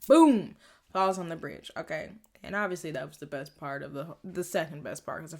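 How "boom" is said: louder punchy boom; sound effect boom. Shown as a demonstration. Recapping the arrow crashing on the bridge, punchy boom. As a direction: slam the word down like an impact.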